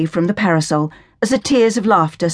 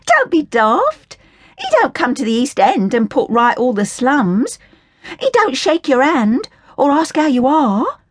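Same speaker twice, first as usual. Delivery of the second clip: put-on higher, scornful working-class girl voice; indignant Cockney orphan girl; 8 semitones higher than usual. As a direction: sneer at the Sally Army in a higher, cheeky voice. Hilda rants about the king.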